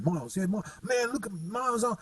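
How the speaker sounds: light, quick mock English accent on the phone. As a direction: toss it off like a London bloke calling a mate. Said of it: "in a Cockney accent, actually, an English accent".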